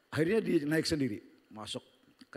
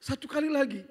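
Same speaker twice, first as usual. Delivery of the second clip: put-on pleading child; 13 semitones higher, 5 dB louder than usual. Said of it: "Dia bilang begini".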